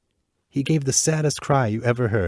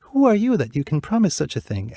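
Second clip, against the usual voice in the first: higher, gentle princess voice; tender princess; 7 semitones higher, 4 dB louder than usual. Acted. Princess to the bluebird at her window, gentle and higher. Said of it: "asked the girl".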